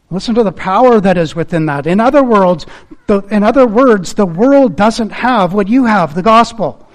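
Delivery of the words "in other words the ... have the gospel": normal talking voice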